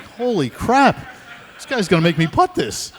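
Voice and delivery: loud, high-pitched incredulous outburst; retold outraged amazement; exasperated disbelief. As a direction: blurt it out, scandalised by a friend. Retold disbelief at rival's refusal.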